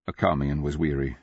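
usual speaking voice

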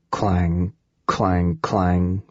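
low, drawn-out ringing clangs; imitated metal clanging. A narrator's reading. Narrator voicing the noise in the shaft, clanging. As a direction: toll each word low like struck metal.

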